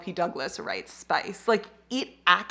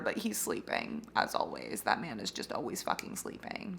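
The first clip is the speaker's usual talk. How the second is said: whispering so the dog sleeps on; hushed, breathless whisper; 10 semitones lower, 12 dB quieter than usual. Real, not acted.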